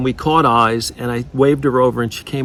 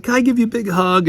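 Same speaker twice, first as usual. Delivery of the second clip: retold plea pitched much higher; moved waitress; 9 semitones higher, faster than usual. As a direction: lift it up high, eager and touched. Waitress moved, asking to hug.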